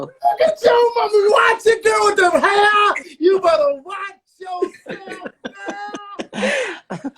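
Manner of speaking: very high, loud hyped-up compliment; gushing shout. Real, not acted. Guest hyping host's mother's hair, squealing shout. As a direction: shriek your admiration, drawing it out.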